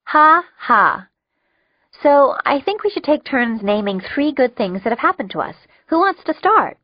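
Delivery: high, cheerful and upbeat; chirpy group leader voice; bright. A narrator's reading. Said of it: "I said brightly".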